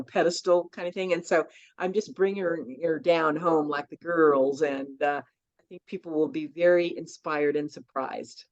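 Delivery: ordinary talk, nothing marked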